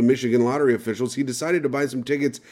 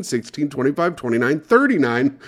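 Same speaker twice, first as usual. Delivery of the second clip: usual speaking voice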